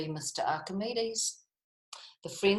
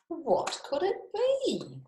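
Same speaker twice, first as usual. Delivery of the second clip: usual speaking voice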